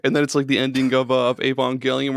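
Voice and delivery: usual speaking voice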